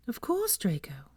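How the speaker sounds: soft, slow, kind whisper; kindly. A narrator's reading. Luna forgiving Draco, kind and whispered. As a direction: reassure him gently. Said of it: "said Luna kindly".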